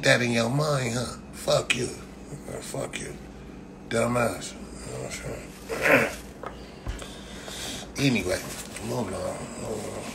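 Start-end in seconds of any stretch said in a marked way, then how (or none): 2.6-3.1 whispered fuck you aside; whispered insult. Real, not acted. 4.5-6.1 whispered aside. Real, not acted. 8.9-10.0 muttered aside. Real, not acted. Trailing muttered repeat.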